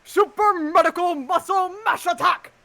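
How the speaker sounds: battle shout. Acted. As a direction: bellow the attack name. Shouting signature attack.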